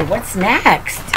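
plain reading voice

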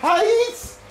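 sudden high yelp of pain; sharp yelp. Real, not acted. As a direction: yelp as the heat bites. Grabbing hot corn, burns fingers.

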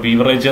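ബീവറേജ് as "BEE-va-rayj"; long "bee" and "-rayj" in ബീവറേജ്; common mispronunciation. Shown as a demonstration. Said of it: "എന്ന് പറയരുത്".

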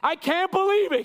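high, loud astonished cry; frantic disbelief. Acted. Preacher acting Paul's frantic disbelief at the church. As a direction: throw up your hands in disbelief. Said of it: "He did a frantic screaming".

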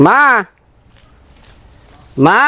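short call with a sharp upward pitch. Real, not acted. Calling his mother from the yard, calling out.

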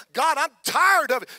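loud, high, fed-up cry to God; a little chippiness. Shown as a demonstration. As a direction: let some fire into it, complaining to God. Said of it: "You get a little chippiness in your voice".

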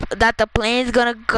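ordinary talk, nothing marked

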